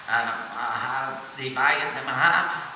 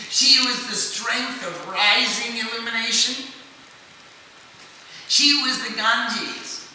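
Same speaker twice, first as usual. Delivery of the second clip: scripture recitation; 5 semitones higher than usual. Acted. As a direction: intone each name like a litany. Recites names of the goddess.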